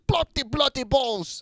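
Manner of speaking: exaggerated cockney "Bloody, bloody balls". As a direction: ham up a rough English bloke. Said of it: "it's like".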